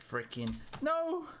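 drawn-out high frustrated no; frustrated yelp. Real, not acted. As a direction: cry out as it fails. Pack opening goes wrong.

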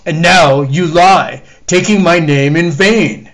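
loud, booming divine judgment; wrathful God. Acted. The Lord condemning the lying dog, booming anger. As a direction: thunder judgment down on the liar.

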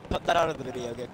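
normal talking voice

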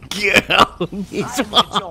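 slow, high put-on ogre holler; Shrek impression. Shown as a demonstration. Gamer repeating the movie line for teammates, Shrek holler. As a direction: stretch it out as the Scottish ogre. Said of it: "I don't think Shrek ever said".

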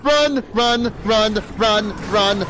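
high panicked shouts of run; frantic, breathless repeated run; being chased. Real, not acted. Fleeing enemy, shouting run.

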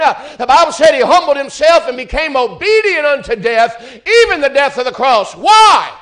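building from firm preaching to a loud, high shout; preaching at full force. Acted. Preacher to the church, building to a shouted why. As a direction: rise and rise until the question rings out.

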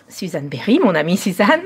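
usual speaking voice

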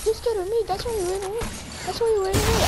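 high squeaky scolding; high-pitched mock taunt; high Elmo-like voice. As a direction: scold him in a puppet voice. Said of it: "Do I sound like Elmo?".

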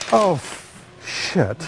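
sudden slip, then a dropped curse; startled curse. Real, not acted. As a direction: blurt it out as something goes wrong. Mishap mid-sentence on air.

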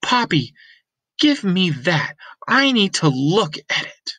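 insist, hand held out; firm girl Lena. Acted.

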